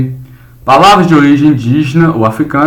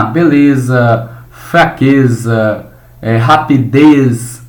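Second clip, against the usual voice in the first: slow example words; much slower than usual. Shown as a demonstration. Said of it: "teacher models the words".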